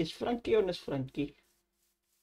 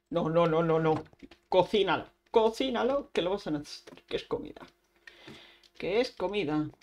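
normal talking voice

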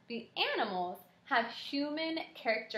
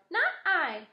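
high-pitched little mouse; mouse's line; 7 semitones higher, 4 dB louder than usual. A narrator's reading. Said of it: "said the mouse".

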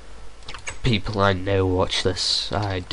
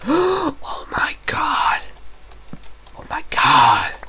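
high, slow breathy gasp of disbelief; shocked whisper; slower than usual. Real, not acted. Shocked at the sight.

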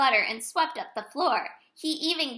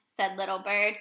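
usual speaking voice

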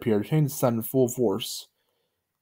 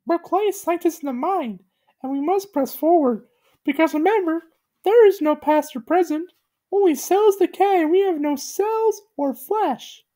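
very high, earnest cartoon voice; squeaky solemn speech; Gumby's high voice. A narrator's reading. Gumby lectures Pokey on decay, very high voice. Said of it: "Gumby said".

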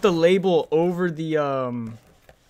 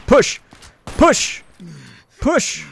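shouted command; 6 dB louder, much slower than usual. Real, not acted. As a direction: bark the push order at the team. Commanding push in firefight.

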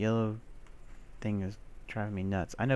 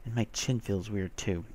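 ordinary talk, nothing marked

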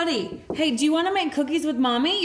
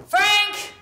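calling out; 5 semitones higher, 5 dB louder than usual. Acted. Mom calling husband again.

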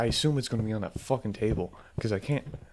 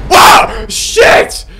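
high, explosive shout of shock; startled scream; 17 dB louder than usual. Real, not acted. Player scared a second time, startled scream. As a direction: scream and swear in fright.

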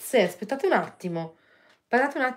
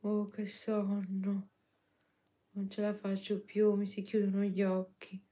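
sleepy; 10 dB quieter than usual. Pretend. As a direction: yawn it out, eyes closing. Voicing the sleepy dog.

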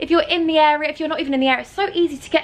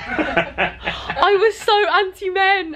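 laughing; 7 semitones higher, much slower than usual. Real, not acted. Laughing at her past self.

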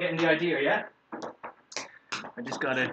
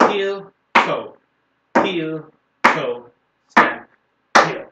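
calling dance steps; 10 dB louder, much slower than usual. Shown as a demonstration. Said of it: "dance teacher calling steps".

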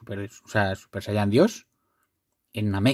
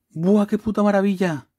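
amazed; 9 semitones higher than usual. Real, not acted. Marveling at a rare card.

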